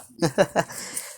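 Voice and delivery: short high character laugh. Acted. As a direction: chuckle before the greeting. Kun laughs greeting Bomb.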